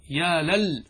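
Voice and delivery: ordinary delivery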